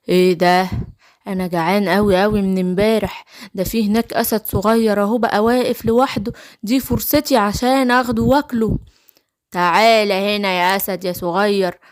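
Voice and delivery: low-pitched wolf character voice; low, menacing storybook wolf; hungry wicked wolf. Acted.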